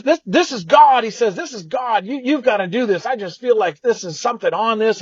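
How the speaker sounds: gushing friend urging him on; excited friend. Retold or imitated. Friend on the phone insisting, excited and high. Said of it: "Sam got super excited".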